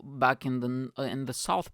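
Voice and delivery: usual speaking voice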